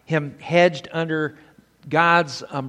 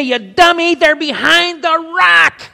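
put-on high, loud drunken yell at the screen; dad yelling at the TV; 13 semitones higher, 10 dB louder than usual. Father yelling at TV cowboys, loud and high. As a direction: bellow a warning at the cowboys. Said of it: "he'd stand up and he'd say".

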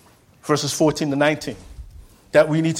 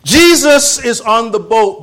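preaching cry; 7 semitones higher, 9 dB louder than usual. Real, not acted. Preacher cries out story.

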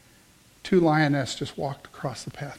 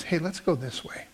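soft, gentle nudge; 8 dB quieter than usual. Acted. Believer alerting those around him to danger, gently. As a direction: say it softly, guiding without pushing. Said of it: "wonderful, gentle voice".

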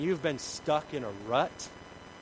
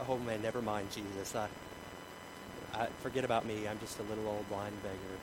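meek backing down; 5 semitones lower, 6 dB quieter than usual. Acted. Acts beggar backing down.

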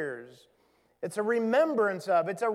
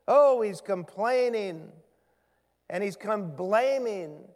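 ordinary talk, nothing marked

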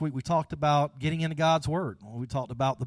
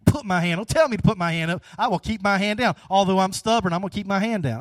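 raised, defiant voice of a stubborn listener; acted stubbornness, louder and higher; mock stubborn refusal; 4 semitones higher, 6 dB louder than usual. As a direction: dig in with playful defiance. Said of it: "Some of y'all so stubborn".

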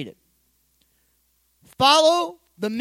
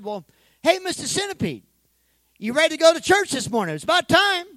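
a cheerful call, pitched up, louder at the end; calling the centipede; 5 semitones higher, 4 dB louder than usual. Acted. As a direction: call down into the box, eager. Pastor calling to pet centipede.

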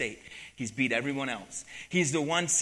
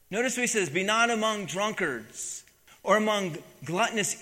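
sermon delivery, building in force; preaching; 4 dB louder than usual. Acted. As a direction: preach it to the congregation. Preaches the spiritual lesson.

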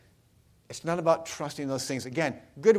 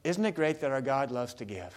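ordinary talk, nothing marked

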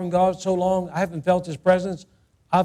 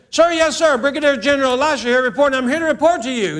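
higher, louder, snappy military report; mock soldier reporting; 7 semitones higher, 4 dB louder than usual. Elijah reporting to God like a soldier, loud and high. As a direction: bark it like a soldier saluting a general. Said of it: "and so Elijah said".

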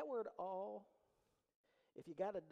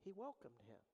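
softly spoken; 6 semitones lower, 6 dB quieter than usual. Shown as a demonstration. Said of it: "Now understand what that means".

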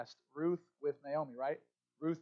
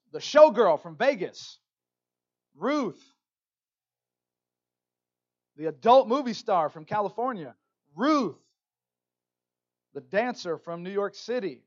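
mock scandalized label; 3 semitones higher, 12 dB louder than usual.

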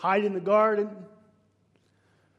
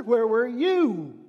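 accusing; 4 semitones higher, 7 dB louder, slower than usual. Acted. Voicing Cain accusing God.

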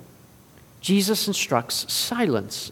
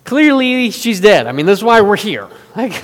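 scoffing, rising to loud disbelief; mocking; 4 semitones higher, 7 dB louder than usual. Hired mourners laugh at Jesus, mocking and dismissive. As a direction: puff dismissively, then argue it loudly. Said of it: "they mock Jesus for this".

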